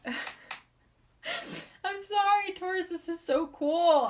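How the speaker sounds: real tears caught in the throat mid-sentence; crying while talking. Real, not acted. Reader to Taurus viewers, overcome and crying. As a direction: voice breaks high into tears, then pushes on. Tearing up during the Taurus reading.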